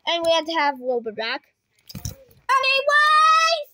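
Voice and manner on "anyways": long, high, loud Anyways; drawn-out yell. Pretend. Host yelling over the noise, slow and high. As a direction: stretch it out and belt it.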